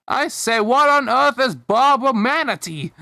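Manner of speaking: put-on high British accent, indignantly questioning; high-pitched British voice. A narrator's reading. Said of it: "came a high-pitched British voice".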